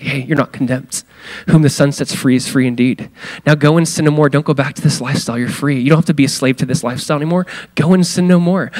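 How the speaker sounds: gentle reassurance. Shown as a demonstration. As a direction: reassure her gently that she is free. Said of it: "hearing the heart of Jesus".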